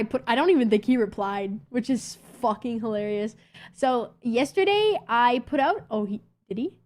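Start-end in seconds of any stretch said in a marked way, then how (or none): none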